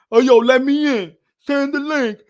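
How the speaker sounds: lightly mock a freeloader asking to get in; mocking freeloading viewers who demand the stream link; mocking pushy viewer. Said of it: "I don't like when people be like".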